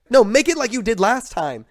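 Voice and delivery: high-pitched mock-insistent teacher; teacher pleading over a shoulder, pitched well up; imitating insistent teacher. Teacher nagging student in retold story.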